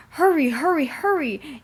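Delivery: high, loud, breathless urging; urgent puffing. A narrator's reading. Thomas urges himself on to reach James, loud and high. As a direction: puff it out, hurrying hard. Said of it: "he puffed".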